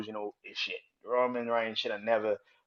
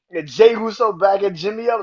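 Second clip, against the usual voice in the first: excited yelling; 9 semitones higher, 12 dB louder than usual. Real, not acted. Excited retelling continues.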